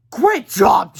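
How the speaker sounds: loud sarcastic shout; angry shout. A narrator's reading. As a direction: snap it out loud and bitter. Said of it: "shouted yesterday George angrily".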